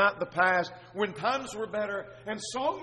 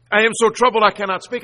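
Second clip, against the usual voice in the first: loud, raised voice of distress; troubled outcry; 4 semitones higher, 13 dB louder than usual. Acted. Psalmist unable to sleep, crying to God, troubled outcry.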